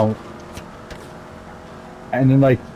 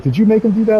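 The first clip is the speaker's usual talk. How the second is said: accusing coworkers; 9 semitones higher than usual. Retold or imitated. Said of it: "some people were like".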